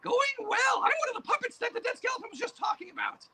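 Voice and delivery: a high cackling boogeyman; boogeyman puppet voice. Acted. Puppet boogeyman greets the interviewer in a high creepy voice. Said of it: "Hey, boogeyman, come over here".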